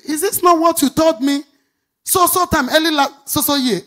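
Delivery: loud, high exasperated reproach; retold angry husband. Husband scolding his wife for ignoring the warning, loud and high. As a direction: throw the accusation back at her.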